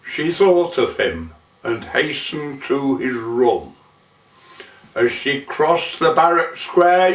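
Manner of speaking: usual speaking voice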